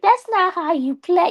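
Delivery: high mocking skeptic voice; squeaky know-it-all protest; doubting gamer. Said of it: "be like".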